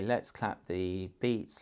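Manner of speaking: drawn-out teacher prompt; slow and deliberate, as if clapping along; slow, clear prompt. Shown as a demonstration. Parent guiding a child to clap syllables, slowly. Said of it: "So I say".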